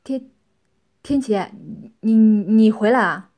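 flustered stutter, loud on the last words; stammering. A narrator's reading. Tianxiang greeting Tianqi after years apart, stammering. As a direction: stumble over her name, then blurt it out. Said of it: "结结巴巴地说道".

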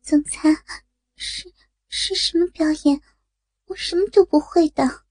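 high, hesitant, timid voice; worried. Acted. Secretary asking the CEO, worried and timid. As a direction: ask nervously, stumbling at the start. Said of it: "元秀担心地说".

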